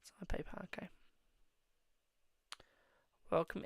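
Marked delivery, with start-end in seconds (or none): 0.0-0.9 quick whisper off to the side; whispered aside. Real, not acted. Streamer telling a viewer about payment, whispered aside. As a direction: drop your voice to a whisper.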